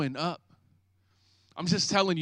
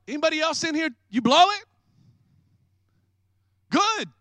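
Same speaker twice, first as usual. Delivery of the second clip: ordinary talk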